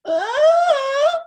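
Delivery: high, loud drawn-out cry; protesting baby wail. Acted. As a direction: wail like a baby left in the crib. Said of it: "And the baby goes".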